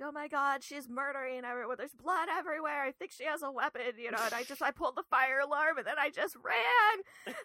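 hammed-up frantic report to the dispatcher; mock panic. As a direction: play a frantic nine one one caller. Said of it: "freaking out and being like".

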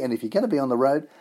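ordinary talk, nothing marked

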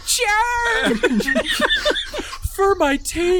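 very high, squeaky kobold charge, laughing; hammed-up squeal of a war cry; mock battle cry. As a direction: cry out like a tiny warrior, cracking up. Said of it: "Just be like".

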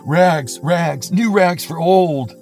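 clear calling voice. Acted. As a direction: call out to the whole alley like a peddler. Ragman calling in streets.

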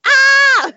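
high, loud mock scream; a hammed-up panicked shriek. Dread of living together.

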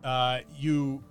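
ordinary talk

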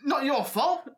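put-on high, incredulous old showman; indignant ringmaster. Ringmaster to the one who hurt his acrobat, indignant. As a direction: echo the excuse in high-pitched outrage.

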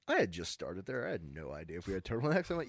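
ordinary talk, nothing marked

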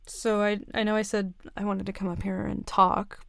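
ordinary delivery

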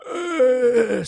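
loud high waking groan; stretching groan. Retold or imitated. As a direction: groan big while stretching. Dad stretching in the morning.

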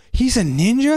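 put-on high-pitched child's incredulous question; amazed little nephew. Little nephew questions the adults about the ninja, amazed child voice. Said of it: "the little nephew was like".

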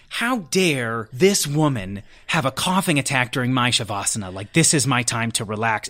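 hammed-up how-dare-she huff; indignant inner voice. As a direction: play up the petty indignation. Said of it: "I'm like".

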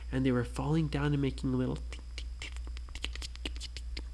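soft unvoiced tink, tink like falling gems; tinkling sounds. Parent telling a bedtime story, whispering tinks. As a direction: whisper the tinks, light and quick.

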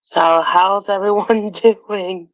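slow, awkward laughing greeting; nervous laugh. Real, not acted. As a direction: laugh shyly through the question. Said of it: "Sorry, I don't usually get a chance to talk".

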